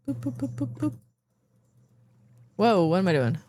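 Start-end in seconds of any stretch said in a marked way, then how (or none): 0.1-0.9 playful boops. Real, not acted. Playful noises.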